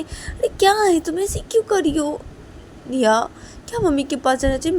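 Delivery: half scold, half soothe; exasperated mother. Acted. Mother telling her sobbing daughter her mom won't come now, scolding then coaxing.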